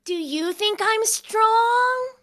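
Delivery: high, loud, stretched-out question; eager excitement. Acted. Totoi asking Serafina about strength, eager childlike voice. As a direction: ask it slowly, bursting with hope.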